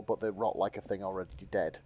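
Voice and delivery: ordinary delivery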